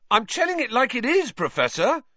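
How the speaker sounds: high, light cheeky reply; cheeky retort. A narrator's reading. Jordan replies to the professor, cheeky retort. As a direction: talk back innocently.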